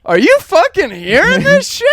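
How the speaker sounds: very high, overblown disbelief; hammed-up astonishment breaking into a laugh; mock outrage and amazement. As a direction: act floored by a radio ad. Escalating the radio bit on dad.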